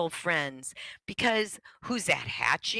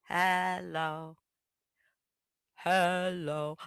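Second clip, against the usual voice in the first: "Hello, hello" sung low; lower voice; much slower than usual. Shown as a demonstration. Bird sings "Hello, hello" in a lower voice. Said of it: "sang in a lower voice".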